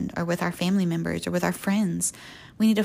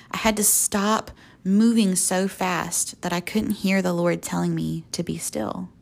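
ordinary delivery

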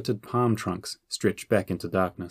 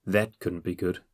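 ordinary delivery

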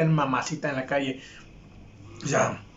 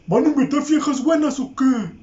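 higher, louder eager voice; hammed-up horny buddy; mock eager party question; mocking the friend; 6 semitones higher, 5 dB louder than usual. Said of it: "el vato decía".

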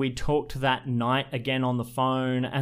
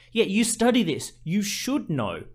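mildly exasperated, higher-pitched retold challenge; exasperated retort; 8 semitones higher than usual. Retelling the argument with a date who studies this, exasperated. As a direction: replay telling someone off, stretching out the point.

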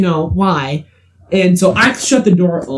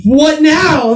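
upset; 10 semitones higher, 3 dB louder than usual. Retold or imitated. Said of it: "And I was like".

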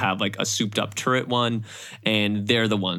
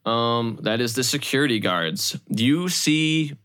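usual speaking voice

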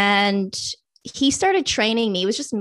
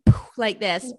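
ordinary talk

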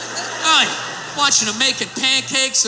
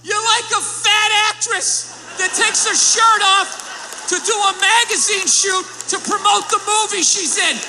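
high, slow sarcastic gushing; mock gushing; 8 semitones higher than usual. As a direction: gush sarcastically at the fireman. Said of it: "Am I gonna sit there like".

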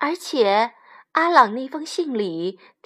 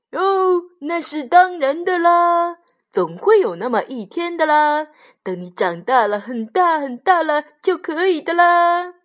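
father sheep; 6 semitones higher, 4 dB louder than usual. A narrator's reading. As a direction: reassure the lamb in a singsong high voice. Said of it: "羊爸爸说".

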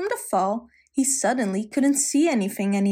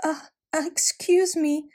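stuttering; 6 semitones higher than usual. A narrator's reading. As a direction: stammer it out haltingly. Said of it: "the man stuttered".

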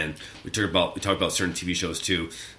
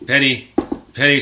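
imitating Sheldon; 4 semitones higher, 8 dB louder than usual. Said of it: "so it's like".

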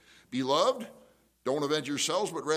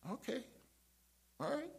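tiny, high, soft acknowledgement; soft answer; 11 semitones higher, 10 dB quieter than usual. Shown as a demonstration. Listener while they're screaming and yelling, softly agreeing. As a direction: stay gentle and unruffled. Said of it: "I just portrayed".

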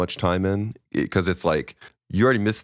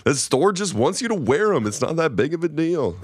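high voice; 10 semitones higher than usual. Retold or imitated. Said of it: "we're like".

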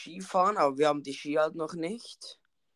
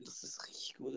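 soft unvoiced whisper; 2 semitones lower, 20 dB quieter than usual. Real, not acted. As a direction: whisper it under your breath.

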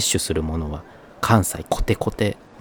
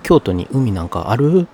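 high, loud disbelieving question; mock surprise; 7 semitones higher, 6 dB louder than usual. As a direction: act amazed that Kyoto has a sea. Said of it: "平気で言ってくる".

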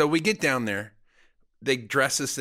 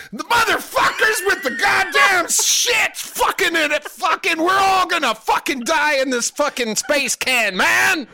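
high, full-blast panicked yelling; wildly overacted audition meltdown; frantic shouted line read; 9 dB louder than usual. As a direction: scream it like everyone is about to die. Acts his frantic botched audition take.

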